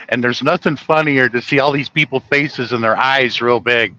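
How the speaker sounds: ordinary delivery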